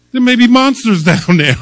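put-on scared child's line, broken by laughter; 1 laughing through a child's fear. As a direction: giggle while voicing the grandson. Grandson fears monsters.

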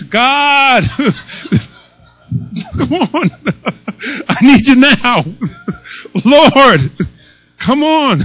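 high, loud pleading, laughing all through; 1 mock desperate prayer. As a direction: ham up a panicked call to God.

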